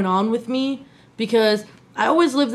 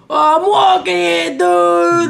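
loud, slow, higher mock New Yorker; New York accent; 8 semitones higher, 6 dB louder, much slower than usual. Mocking how New Yorkers talk, hammed-up accent. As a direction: ham up a tough New Yorker. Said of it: "I'm not walking around here, you know".

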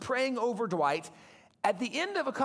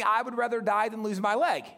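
ordinary talk, nothing marked

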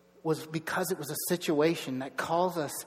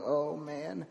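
softer, retold sheepish admission; 7 dB quieter than usual. Dad realizing his kids copy him, sheepish. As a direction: say it small, like a dad caught out. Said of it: "I was just sheepishly going".

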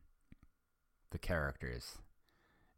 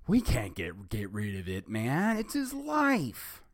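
loud, rising to a high stretched end; barked; 6 semitones higher, 7 dB louder than usual. Acted. Fred Jones barking at the doctor, loud and rising. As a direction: bark it, climbing high on the last words. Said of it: "Jones barked".

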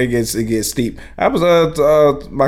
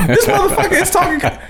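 laughing disbelief. Retold or imitated. Retold reply to cousin.

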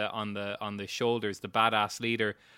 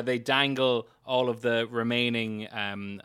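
normal talking voice

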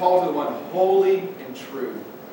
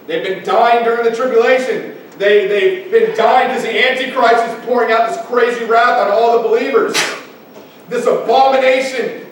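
raised, driving preacher's delivery; impassioned preaching; 5 semitones higher, 4 dB louder than usual. Acted. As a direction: preach it with mounting urgency. Preaches at full force.

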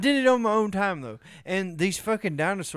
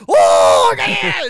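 sudden loud, high mock cry; 10 semitones higher, 12 dB louder than usual. Friend mimics how the Chinese would react, loud mock cry. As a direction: blurt it out as a hammed-up caricature. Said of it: "the Chinese was like".

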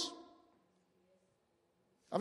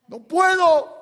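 muerte que grita; 7 semitones higher, 9 dB louder than usual. Pretend. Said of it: "Y la muerte".

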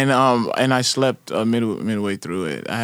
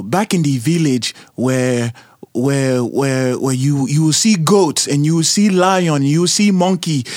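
a lightly put-on African accent, a little louder; 2 semitones higher, 4 dB louder, slower than usual. Host asks athlete for an African accent, playful. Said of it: "Can you do an African accent for us right now?".

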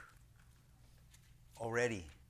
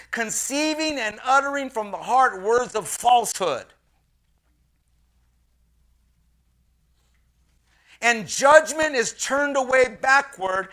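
preaching; 9 semitones higher, 11 dB louder than usual. Acted. Preaches at full force.

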